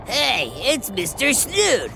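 usual speaking voice

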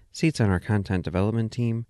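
ordinary talk, nothing marked

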